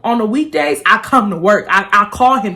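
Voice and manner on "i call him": normal talking voice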